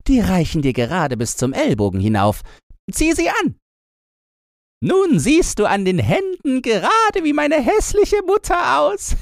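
put-on high, brash young robber girl; lively high-pitched girl voice. A narrator's reading. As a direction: play the bold little robber girl, high.

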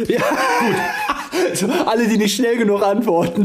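high, laughing through the words; giggling while talking. Real, not acted. Cracking up at joke.

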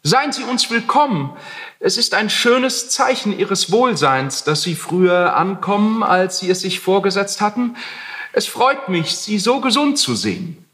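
overly friendly, calling down sweetly. A narrator's reading. Heinrich greets his landlord from upstairs, mock friendly. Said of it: "rief er freundlich hinab".

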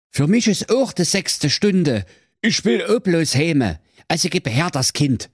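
hoher, behäbiger Pfarrer mit Dialekt; fetter Pfaffe. Acted. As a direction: leiert es als müder Pfaffe runter. Betrunkener Pfaffe spricht. (hohe Pfaffenstimme)

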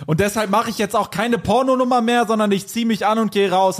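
high, huffy announcement; indignant refusal. A narrator's reading. Character declares refusal, leaving in story.

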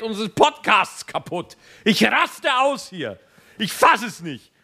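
host ranting about the cat ruining the recording; exasperated rant. Real, not acted. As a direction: vent at the noisy cat, fed up.

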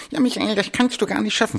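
high squeaky cartoon gnome voice; Mickey Mouse voice. The little green gnome mocking Michael in a high cartoon voice. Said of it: "spricht dann noch mit einer Mickey-Maus-Stimme".

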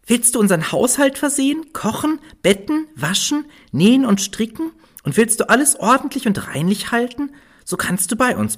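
high, kindly little dwarf voice. Acted. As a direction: offer a home as friendly dwarfs. Dwarfs make an offer.

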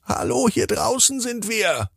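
sehr hoch und sanft rufend; hohe Sternenstimme. Acted. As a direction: wie ein Stern aus der Ferne, hoch und leise. Stimme ruft von draußen. (ein Stern ruft Tina sanft durchs Dachfenster, sehr hoch)

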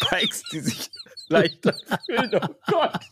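laughing through the words; high, breaking into giggles; stifled giggles. Real, not acted. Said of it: "hör auf zu lachen".

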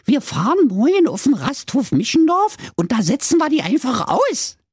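high, triumphant wife voice; calling out excitedly. Acted. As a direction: cry out the bright idea as the scheming wife. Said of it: "rief da die Frau".

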